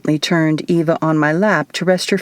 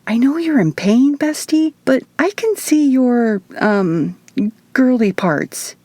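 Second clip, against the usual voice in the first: higher witch voice, not truly whispered; a hint of conspiratorial hush; mock whisper; 7 semitones higher than usual. A narrator's reading. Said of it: "Isadora whispered".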